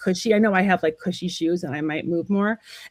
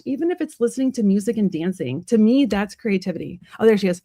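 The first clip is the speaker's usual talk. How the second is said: ordinary delivery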